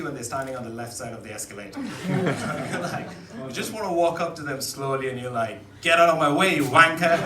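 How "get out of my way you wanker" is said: loud, hammed-up commuter fury; mock angry outburst. Fed-up commuter to tourist blocking the escalator, loud mock anger. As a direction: bellow it at whoever is blocking you, playing up the annoyance.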